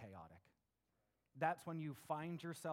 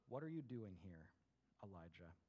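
soft, much quieter voice of God; gentle whisper; 9 semitones lower, 9 dB quieter than usual. A narrator's reading. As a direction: speak it as a hushed, gentle whisper. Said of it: "of a gentle whisper".

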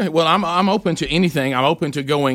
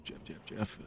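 whispered; 4 semitones lower, 23 dB quieter, slower than usual. Real, not acted. Lamenting the caller who missed.

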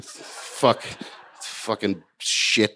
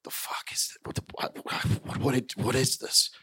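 hushed, breathy whisper to herself; under-the-breath panic; panicked whisper; 9 dB quieter than usual. Retold or imitated. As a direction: whisper it in disbelief. Whispering to self, tearing up.

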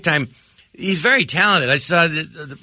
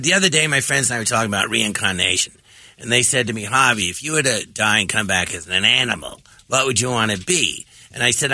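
Harvey Fierstein impression; 7 semitones lower than usual. Comic imitating Harvey Fierstein asking a guest, low-voiced. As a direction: lower voice, like a talk show host asking his guests. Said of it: "It's a really good impression of Harvey Firestein".

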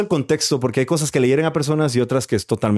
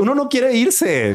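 mock whiny; 11 semitones higher, slower than usual. Broma exagerada.

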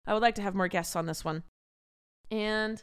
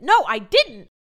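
higher, louder frustrated plea; frustrated yelling; 8 semitones higher, 5 dB louder than usual. Real, not acted. Pleading with TikTok to fix her feed, loud and high. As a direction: shout your protest at the app.

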